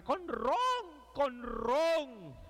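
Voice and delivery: high crowd cry of "Con ron"; high mock crowd shout; crowd yelling. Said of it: "Y la gente gritaba".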